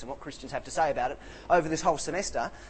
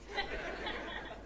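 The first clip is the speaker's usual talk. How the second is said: breathy high laugh; 11 dB quieter than usual. Real, not acted. Laughs at own story.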